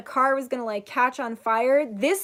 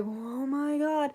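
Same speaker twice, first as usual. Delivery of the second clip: slow-motion dread; 7 dB quieter, slower than usual. Retold or imitated. Reliving flipping through the air.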